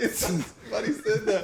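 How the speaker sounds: high, slow words through laughter; laughing. Real, not acted. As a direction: giggle out the words. Cracking up over joke.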